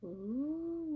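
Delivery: plain reading voice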